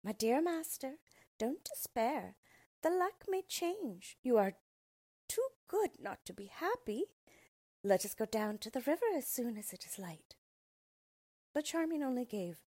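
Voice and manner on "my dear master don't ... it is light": small dog's voice, higher and gentle; a tiny loyal dog comforting his master; little dog Frisk. A narrator's reading. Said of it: "Frisk heard them and said".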